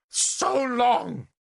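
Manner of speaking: high, startling outburst; sudden interjection. Acted. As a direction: burst in out of nowhere. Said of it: "Morgan astonished us all by interjecting".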